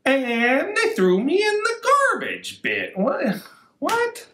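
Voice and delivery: high squeaky mock shock; mock outrage. Librarian hamming the rhyme's punchline, high mock shock. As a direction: play up the silly surprise.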